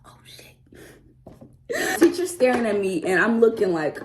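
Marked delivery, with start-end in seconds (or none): 0.1-0.6 breathy whispered oh shit; whispered oh shit in class; whispered curse. Retold or imitated. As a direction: curse under your breath. Caught out, under her breath.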